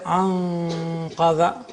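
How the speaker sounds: "anqadha" with a long nasal hum before q; ikhfa. Shown as a demonstration. Teacher showing ikhfa from the Quran, long hidden n in "anqadha". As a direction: stretch the hidden n of "anqadha" at the q position. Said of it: "because it is ikhfa".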